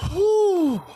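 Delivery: high, loud breathy whew; loud exhale whew. Real, not acted. Streamer out of breath after workout, loud whew. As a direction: blow out hard after effort.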